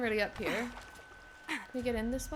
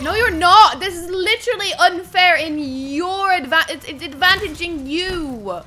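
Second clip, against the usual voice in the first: higher, much louder protest; real outraged protest; indignant shout; 5 semitones higher, 14 dB louder than usual. Real, not acted. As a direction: rant at the unfair game. Answering enemy NPC line.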